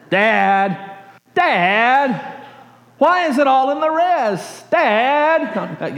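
put-on high, drawn-out child's whine calling Dad; whiny kid. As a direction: nag your father like an annoyed kid. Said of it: "if you're a kid, you know how to say that".

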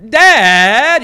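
"Dad" pulled out loud and high with a vowel that bends as it goes; "Dad" stretched loud and high, its vowel sliding; whiny kid's Dad. Shown as a demonstration. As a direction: whine "Dad" like a kid who's just heard the impossible. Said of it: "changing vowel in there".

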